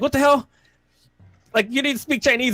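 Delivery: high-pitched incredulous movie-character impression; Chris Tucker. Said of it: "Chris Tucker's like, or his character".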